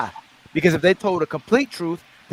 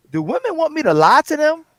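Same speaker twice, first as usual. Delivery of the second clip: much higher, asking a woman in a put-on tone; high mock question; 11 semitones higher than usual. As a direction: pitch it way up like a playful question. Retold question to women.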